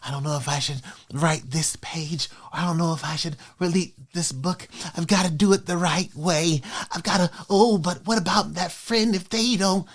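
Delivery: hushed, whispered fretting; a lightly voiced anxious writer muttering doubts; soft nervous whisper; mock hand-wringing worrier. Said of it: "hand-wringing".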